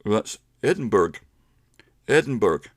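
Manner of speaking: Edinburgh said American style, Edin-burg with a hard g ending; a light American tourist saying Edinburgh; American accent. Retold or imitated. Teacher imitating an American reading Edinburgh. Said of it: "an American might say".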